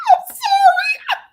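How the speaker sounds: high, loud cracked-up laugh; laughing too hard to speak normally; laughing apology. Real, not acted. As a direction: squeal it helplessly through laughter. Bursts out laughing while apologizing.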